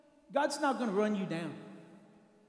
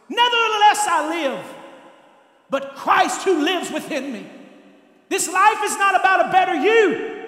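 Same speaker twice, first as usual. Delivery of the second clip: loud, higher scripture proclaiming; preaching at full force; 6 semitones higher, 9 dB louder than usual. Acted. Pastor quoting Paul to the congregation, loud proclaiming. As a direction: proclaim Paul's words to the church.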